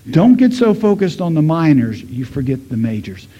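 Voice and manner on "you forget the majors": ordinary delivery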